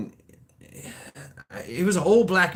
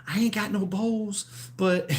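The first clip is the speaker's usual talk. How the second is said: lightly voiced lady, pitched up; the lady's voice; 7 semitones higher than usual. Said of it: "and she's like".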